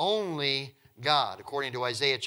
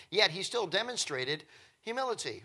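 ordinary talk, nothing marked

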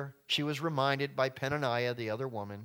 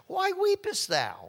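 much higher, slow, syrupy husband's question; clueless husband; 4 dB louder, slower than usual. Acted. Elkanah asking his weeping wife, high and sugary. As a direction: play Elkanah, sweetly baffled. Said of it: "we all can see it when he says it".

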